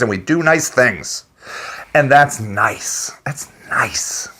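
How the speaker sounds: unvoiced mock-sweet whisper; whispered sarcasm about nice people. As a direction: breathe it out in a mocking hush.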